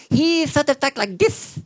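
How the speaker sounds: high, slow iguana throat voice; Cecil Iguana voice. Retold or imitated. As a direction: croak it out like a lizard. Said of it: "the Cecil Iguana".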